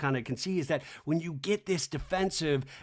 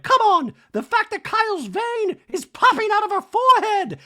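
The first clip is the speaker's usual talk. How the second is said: very high, loud mock outrage; hammed-up incredulous shriek; Dorit ranting; 9 dB louder than usual. Dorit complaining in a talking head, shrieked mock outrage.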